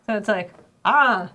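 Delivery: usual speaking voice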